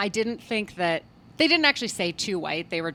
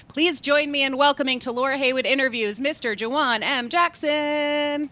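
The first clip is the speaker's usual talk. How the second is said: big, raised guest introduction; show announcer; 7 semitones higher, 5 dB louder than usual. Real, not acted. Host welcoming Mister Juwan M. Jackson to the interview, grand announcer. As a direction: announce the guest loudly, stretching the surname.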